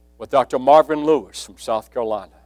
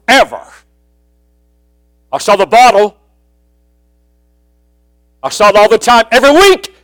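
much higher and louder, fervent; full-force preaching; 4 semitones higher, 9 dB louder than usual. Acted. Preacher telling congregation about his dad's bottle, full force. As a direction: preach it hard over the crowd.

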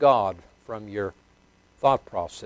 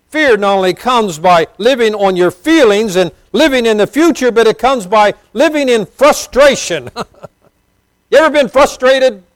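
high, loud pulpit cry, slowed on key words; fervent preacher lifting his voice high and loud; full-force preaching; 13 semitones higher, 13 dB louder than usual. Acted. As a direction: preach it out to the back row.

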